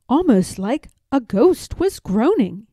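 ordinary delivery

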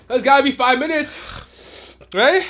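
high, loud strained voice; strained in pain. Real, not acted. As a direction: push the words out through pain. Suffering through chip burn.

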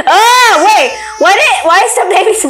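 a burst of laughing alarm; startled laughing shout. Real, not acted. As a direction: yelp in shocked amusement. Startled by green baby.

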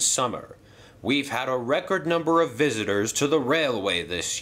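hearty stately controller, pleased then serious; railway boss voice. Acted. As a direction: address the engines like a proud boss. Hatt addresses the engines.

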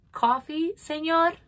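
high, sweet little voice; sweet cockroach voice. Acted. Martina offers coffee sweetly.

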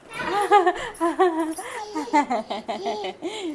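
loud high rising laugh; delighted laugh. Real, not acted. Baby arrives.

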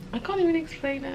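"I can't even explain it" lifted up high. Real, not acted.

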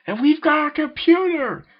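a high gleeful yell. A narrator's reading. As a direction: yell it out with glee. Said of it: "Zane and Nathan simultaneously yelled".

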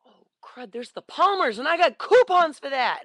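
light up at a bargain you just spotted; excited. Retold or imitated.

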